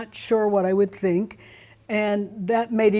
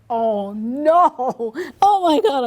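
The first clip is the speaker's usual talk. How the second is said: high panicked oh no, rising to a yelp; mock panic; 6 semitones higher, 5 dB louder, much slower than usual. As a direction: act out the shock of fearing the worst. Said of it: "I'm like".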